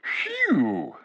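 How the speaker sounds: high breathy phew at a foul smell; disgusted gasp. Acted. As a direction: recoil from the stench. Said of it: "gasped Eustace".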